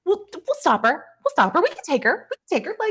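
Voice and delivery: high, hurried fan voice; eager fan. Acted. Fans offer to stop the pursuing woman, high and eager. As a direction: offer help in a high, excitable voice.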